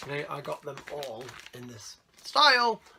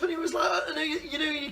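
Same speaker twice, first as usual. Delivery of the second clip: laughing; 10 semitones higher than usual. Real, not acted. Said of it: "laughing while talking".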